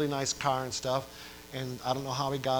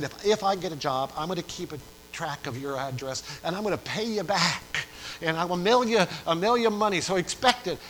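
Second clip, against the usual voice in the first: earnest promise; 4 semitones higher, 5 dB louder than usual. Retold or imitated. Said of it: "But he said".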